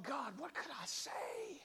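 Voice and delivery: slow, whispered inner prayer. Retold or imitated. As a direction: murmur it to God as a private thought. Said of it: "And I thought".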